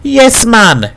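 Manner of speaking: high-pitched, admiring agreement; proud. A narrator's reading. Wife admires her husband, proud. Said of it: "regarded her husband with pride".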